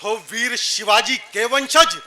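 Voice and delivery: drawn-out verse, falling low at the close; poetry recitation. Acted. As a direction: recite with stately, stretched phrase endings. (ठहराव के साथ वीर रस काव्य पाठ)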